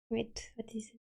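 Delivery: soft puzzled whisper; hushed curious aside. Real, not acted. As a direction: mutter it under your breath. Puzzled aside while checking chat.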